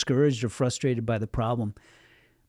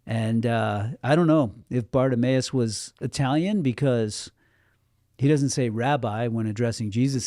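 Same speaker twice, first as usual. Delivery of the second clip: ordinary delivery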